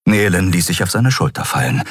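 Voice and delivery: usual speaking voice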